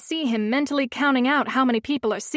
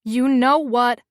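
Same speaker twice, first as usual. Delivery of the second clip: louder, stern scolding start; trying to sound severe; 5 dB louder, slower than usual. A narrator's reading. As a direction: scold her, as firmly as you can. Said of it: "I try to sound severe".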